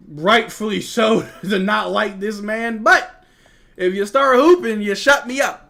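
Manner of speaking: fired-up fan ranting about McDaniels; worked up. Real, not acted. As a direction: push it out like a passionate rant. Heated rant about a player.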